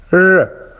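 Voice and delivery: R said as a held "ruh"; R as the isolated sound in row; consonant sound demo. Shown as a demonstration. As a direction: sound out R, not the letter name. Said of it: "as in row".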